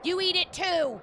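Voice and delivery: high, loud mock-vengeful yell; gloating shout. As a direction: yell it at the next victim in play. Gloating shout at game character.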